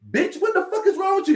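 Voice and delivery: loud, very high-pitched mock outrage; cussing someone out. Woman in a bathroom stall cussing out the friend, high angry outburst. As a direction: imitate her tearing into a stranger. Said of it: "start cussing my friend out".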